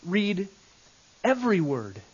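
"Read" set apart, then "every word" stressed; emphatic every word. Shown as a demonstration. Teacher showing how to read a passage, each word stressed. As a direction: pause after "Read", lean on "every". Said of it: "read by emphasizing every word".

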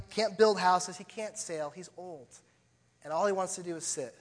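usual speaking voice

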